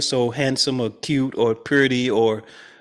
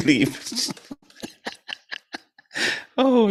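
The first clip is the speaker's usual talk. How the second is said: ordinary talk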